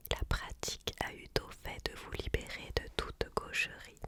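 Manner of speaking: usual speaking voice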